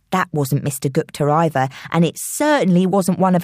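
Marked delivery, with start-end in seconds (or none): none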